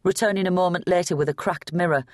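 usual speaking voice

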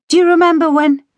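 put-on smiling back at old times; light fond recollection; reminiscing. A narrator's reading. Barbara reminisces with father.

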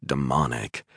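ordinary delivery, nothing marked

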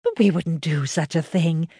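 lightly voiced wife, earnest; Margaret, farmer's wife. A narrator's reading. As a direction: insist to the girl that they'd never betray her. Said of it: "said Margaret".

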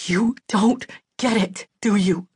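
tense, angry stage whisper, still voiced. A narrator's reading. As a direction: hiss it lightly into their face. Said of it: "leaning down to whisper angrily in my face".

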